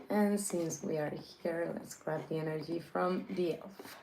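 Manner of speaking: hushed whisper under the breath. Real, not acted. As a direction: whisper it quietly to yourself. Quiet aside during game play.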